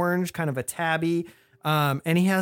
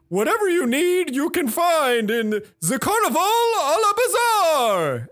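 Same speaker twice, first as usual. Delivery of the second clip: grand showman cat; 12 semitones higher, 5 dB louder than usual. Acted. Cat host announces the bazaar before vanishing, grand and high. Cat's grand closing pitch.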